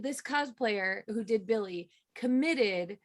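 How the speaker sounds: usual speaking voice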